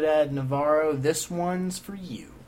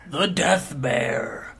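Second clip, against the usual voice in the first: slow dramatic trailer voice; ominous movie-trailer voice; much slower than usual. Acted. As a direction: draw it out like a trailer. Dramatic slow repetition of name.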